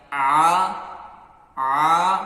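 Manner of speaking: loud open آ pressed from the mid throat; throat sound demo. Shown as a demonstration. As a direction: push out آ from the middle of the throat, finger on it. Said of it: "اس انگلی کو رکھ کر تھوڑا سا دبائیں".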